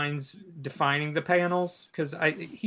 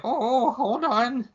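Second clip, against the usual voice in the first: put-on high, slow old lady voice; 9 semitones higher, much slower than usual. Witch stalls the burglar, high and slow old-lady voice. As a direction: draw it out as a high-pitched old woman. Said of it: "She's like".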